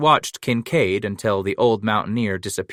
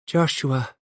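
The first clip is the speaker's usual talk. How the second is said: put-on "Joshua" chimed higher, with a light Spanish accent; imitation English; 5 semitones higher than usual. A narrator's reading. Mexican wife echoing the baby's name in light Spanish accent. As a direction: sing "Joshua" like a Spanish speaker copying English. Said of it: "she chimed in imitation English".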